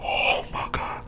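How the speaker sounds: stunned whisper; hushed mock disbelief. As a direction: breathe it out in disbelief. Retold shocked reaction.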